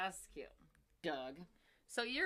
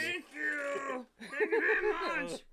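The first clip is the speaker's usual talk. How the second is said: Cookie Monster voice; 6 semitones higher, 6 dB louder, much slower than usual. Acted. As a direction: rumble it out like a furry monster. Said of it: "That's my Cookie Monster voice".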